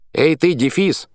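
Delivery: high, loud yell. A narrator's reading. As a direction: bellow it at the hyphen. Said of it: "орал матрос".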